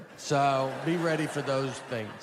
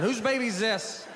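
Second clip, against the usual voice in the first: higher, slow, puzzled ranger voice; 8 semitones higher than usual. Retold or imitated. Ranger on the mountain asking hikers about a baby, puzzled call. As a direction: play a baffled park ranger holding up a baby.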